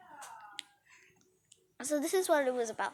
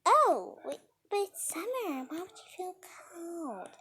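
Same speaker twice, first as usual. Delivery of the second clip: Samantha; 5 semitones higher than usual. Retold or imitated. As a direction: question them in an eerie high voice fading to a whisper. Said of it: "she's like".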